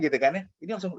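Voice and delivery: usual speaking voice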